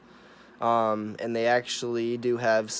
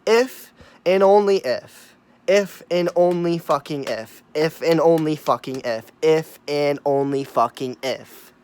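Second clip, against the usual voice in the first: "If and only fucking if" punched louder, each "if" and "only" hit hard; emphatic repetition; 3 semitones higher, 7 dB louder than usual. Real, not acted. Ranting to viewers about the virus, hammering every "if" loudly. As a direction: drill the phrase in, stressing "only" and "if" every time. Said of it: "I gotta emphasize this".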